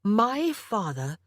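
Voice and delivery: "My father" said slowly, each word weighted; emphasized. A narrator's reading. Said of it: "emphasizing the words".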